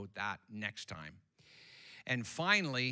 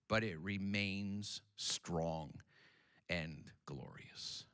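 hushed; 2 semitones lower, 6 dB quieter, slower than usual. Acted. Preacher softly declaring the church strong, hushed. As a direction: drop to a slow, soft reverent hush.

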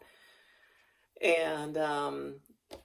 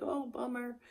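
disappointed horse; 7 semitones higher, 5 dB quieter than usual. Pretend. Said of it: "And he was just like".

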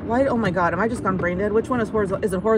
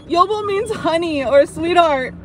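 higher, words breaking through laughter; giggling through the explanation; still laughing; 6 semitones higher than usual. Real, not acted. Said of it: "I'm laughing too hard".

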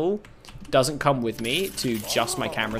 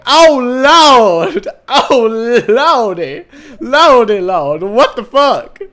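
horrified cry; 9 semitones higher, 13 dB louder, much slower than usual. Pretend. Horrified by bald avatar.

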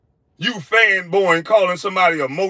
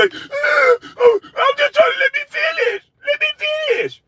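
high, crying, shouted whine of a man begging to finish; mocking whiny plea. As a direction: mimic him like a flustered crybaby.

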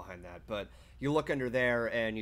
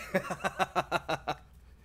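laugh; 7 semitones higher than usual. Real, not acted. Laughing after love you.

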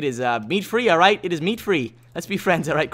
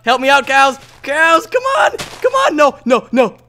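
high pleading yells at the cows; desperate shouting; 9 semitones higher, 8 dB louder, slower than usual. Real, not acted. Gamer yelling at cows for help, high and loud.

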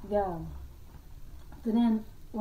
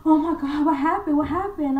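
alarmed mom; 7 semitones higher, 7 dB louder than usual. Retold or imitated. Mom alarmed, woken by screaming.

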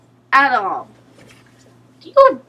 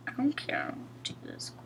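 whisper; 16 dB quieter than usual. Real, not acted. Whispering while someone plays.

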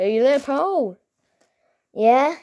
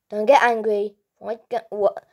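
normal talking voice

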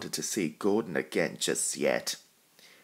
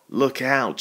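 higher, louder alarmed warning; shout; 6 semitones higher, 9 dB louder than usual. A narrator's reading. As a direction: yell the warning as the ground caves in. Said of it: "shouted Thomas's driver".

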